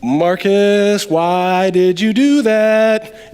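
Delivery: drawn-out, mock-anguished self-scolding; dismayed self-reproach. Preacher as Peter berating himself in the boat, drawn-out dismay. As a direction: stretch it out like a groaning self-reproach. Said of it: "would have been thinking like this".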